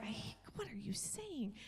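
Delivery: hushed, whispered retold bewilderment; soft, stunned questioning; scared. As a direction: whisper it as if mildly spooked. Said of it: "scared spitless".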